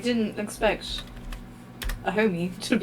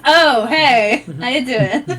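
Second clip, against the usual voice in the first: excited greeting; 3 semitones higher, 11 dB louder, slower than usual. Acted. Player greets the figure who just appeared, excited. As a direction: burst out greeting a newfound friend. Calling out to new friend.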